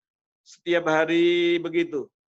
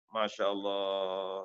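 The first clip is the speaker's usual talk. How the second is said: slow, low, softer Masya Allah; hushed awe; 7 semitones lower, 8 dB quieter, much slower than usual. Real, not acted. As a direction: breathe it out in wonder. Preacher's hushed exclamation.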